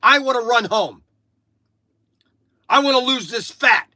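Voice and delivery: imitating son shouting to stop the car; son demanding. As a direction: blurt it out like an eager teen. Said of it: "all of a sudden Frankie goes".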